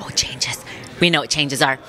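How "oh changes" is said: whispered, knowing and sarcastic. Retold or imitated. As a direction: breathe it out with a knowing sneer. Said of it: "I'm like".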